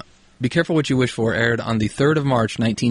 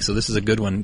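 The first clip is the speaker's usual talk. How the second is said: normal talking voice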